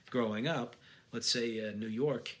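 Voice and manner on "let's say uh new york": usual speaking voice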